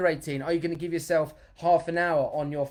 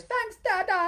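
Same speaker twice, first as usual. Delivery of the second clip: put-on much higher small-child voice; toddler's thanks; 3 dB louder, slower than usual. A dad imitates his toddler son thanking him. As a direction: sweetly thank your dad. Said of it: "he comes up to me and he goes".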